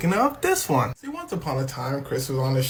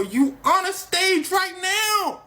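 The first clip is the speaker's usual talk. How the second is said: much higher and slow, incredulous; mock Jerry; 13 semitones higher, 3 dB louder, slower than usual. Jerry retorting to Ricky, high slow incredulous voice. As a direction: squeak it out slowly in disbelief. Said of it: "Jerry go".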